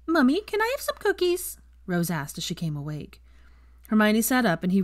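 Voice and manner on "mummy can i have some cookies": put-on high sleepy little girl asking. A narrator's reading. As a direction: beg sweetly like a small child. Child Rose voiced.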